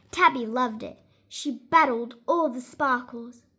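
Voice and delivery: ordinary delivery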